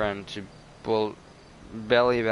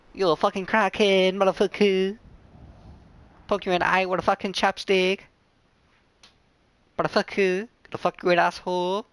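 higher-pitched crude mock Chinese accent; 7 semitones higher than usual. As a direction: slip back into the fake Chinese accent, pitched up. Resumes the mock accent bit.

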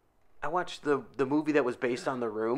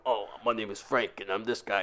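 a lower, softer imitation of Russell Crowe; Russell Crowe impression; 5 semitones lower, 6 dB quieter than usual. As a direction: speak low and soft, the way Russell Crowe talks. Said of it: "and then he's like".

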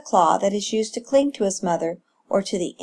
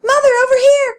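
very high, loud calling; insistent cry; 9 dB louder than usual. A narrator's reading. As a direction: youngster shouting for mother's attention. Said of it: "cried Echo again".

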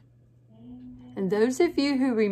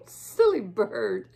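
laughing; 10 semitones higher, slower than usual. Real, not acted.

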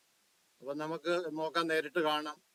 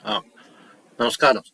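radio show caller; 3 semitones lower, 14 dB louder than usual. Real, not acted. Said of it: "voice echo ആണ്".

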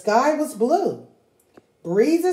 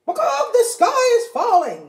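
high, loud frantic hen; panicking hen; 4 dB louder than usual. Acted. Henny Penny believing the sky falls, high frantic panic. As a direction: squawk the alarm.

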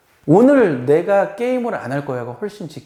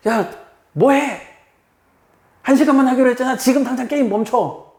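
angry parent; 10 semitones higher than usual. Retold or imitated. Parent scolding a gaming child, high and angry. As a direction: shout at the child to stop the game now.

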